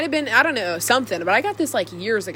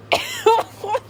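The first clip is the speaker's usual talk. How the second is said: sudden high-pitched laugh; high giggle. Real, not acted. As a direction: burst out in a short giggle. Amused by her own remark.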